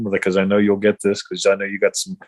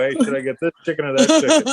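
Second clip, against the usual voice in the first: quick, higher-pitched mock dithering; mock husband calling; 7 semitones higher, faster than usual. Husband phoning his wife from the shop, mock dithering. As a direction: rush the question up high, hammed-up. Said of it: "If I have to call you, I go".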